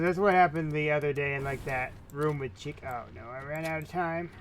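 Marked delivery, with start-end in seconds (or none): none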